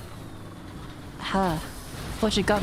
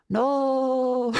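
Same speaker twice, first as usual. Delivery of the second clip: spooky voice; 3 semitones higher than usual. Acted. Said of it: "I'm doing my spooky voice".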